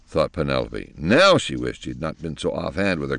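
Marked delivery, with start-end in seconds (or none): none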